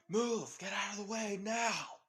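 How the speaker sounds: loud, high shout to clear the way; urgent shouted order. Acted. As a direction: shout like a paramedic pushing through a crowd. Ambulance crew clears the crowd.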